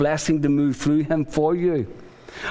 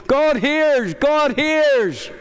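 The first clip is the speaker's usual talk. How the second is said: high, louder call across the yard; mother calling out; 9 semitones higher, 3 dB louder, much slower than usual. Retold or imitated. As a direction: sing out the name like a mother calling her boy. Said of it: "She's going".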